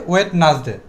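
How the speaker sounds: ওয়েডনেসডে as three parts, "wet-nas-de"; wrong sound. Shown as a demonstration. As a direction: pronounce the middle "nas" in ওয়েডনেসডে. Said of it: "This is wrong sound". (ওয়েডনেসডে, ভুল "ওয়েটনাসডে")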